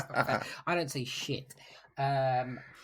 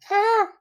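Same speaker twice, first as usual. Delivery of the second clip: short high yelp; a quick, high, loud "ah"; small yelp; 15 dB louder than usual. Shown as a demonstration. Player showing how Krill does not scream, short high yelp. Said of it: "Not like".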